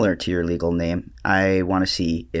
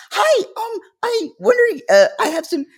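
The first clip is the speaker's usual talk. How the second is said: much higher, timid phone voice; high customer-service voice; 4 dB louder than usual. Retold or imitated. Mal imitating her own nervous call to a bank clerk, much higher. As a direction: pitch way up like calling the bank nervously. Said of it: "my voice gets higher and I'm like".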